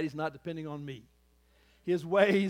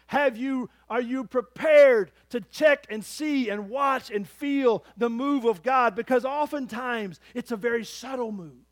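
urgent preaching; 7 semitones higher, 10 dB louder than usual. Acted. Urging the church.

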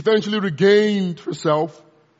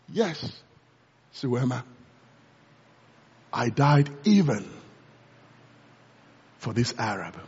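low, soft, tender reply; a quiet, lower voice full of mercy; gentle voice of Jesus; 8 semitones lower, 5 dB quieter than usual. Retold or imitated. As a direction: speak softly like a loving savior.

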